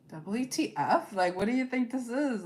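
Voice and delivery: incredulous, slowed "WTF", rising to a sharp question; mock indignant brush-off; exasperated retort. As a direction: push back like someone fed up. Frustrated person confronting someone.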